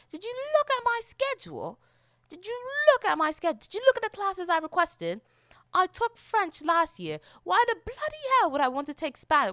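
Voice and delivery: high, loud, exasperated complaint; hammed-up outrage at being put in the wrong class; indignant protest. Acted protest over wrong class placement.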